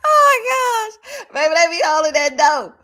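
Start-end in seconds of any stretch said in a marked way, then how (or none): 0.0-0.9 high laughing shriek of delight; shrieking laugh cry. Real, not acted. Reacting to a funny comment, shrieking with laughter. 1.3-2.8 high and loud through laughter; laughing hard. Real, not acted. Repeating her own joke, laughing hard. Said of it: "Oh, my goodness".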